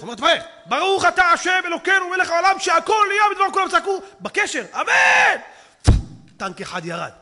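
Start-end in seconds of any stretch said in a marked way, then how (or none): 0.1-5.4 high, loud blessing yelled over the radio; shouted blessing. Retold or imitated. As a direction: bellow the blessing in battle.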